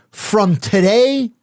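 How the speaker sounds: slow, weighty mock mafia pronouncement; a lightly voiced mob boss decree; mob captain. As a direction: lay it down like a Bonanno captain, drawn out. Mob captain giving his ruling.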